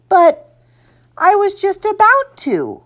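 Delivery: put-on high-pitched little-boy whine; pleading kid voice; protesting child. A narrator's reading. Boy protests losing his toy.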